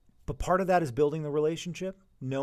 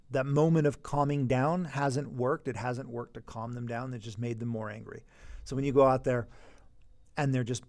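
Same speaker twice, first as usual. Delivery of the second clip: ordinary delivery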